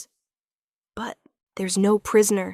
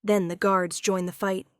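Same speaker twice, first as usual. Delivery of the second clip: ordinary delivery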